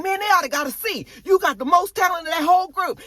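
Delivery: insistent dad. Retold or imitated. Marvin defending himself to Juke, high insisting. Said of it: "he was like".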